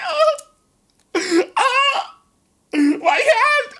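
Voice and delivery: high-pitched hammed-up pain; mock agony. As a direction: wail high over an injured hand. Hurt hand after punching tree.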